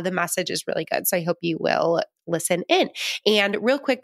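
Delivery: ordinary talk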